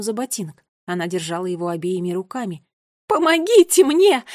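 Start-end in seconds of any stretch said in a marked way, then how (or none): 3.1-4.2 high, loud scream for help; terrified yell. A narrator's reading. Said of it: "в ужасе заорала она". (вопль ужаса)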